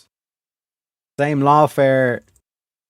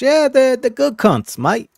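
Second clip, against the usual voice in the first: high-pitched mock Australian accent; squeaky Aussie bloke impression; lightly hammed-up Australian twang; 11 semitones higher, slower than usual. Mocking Australian talk.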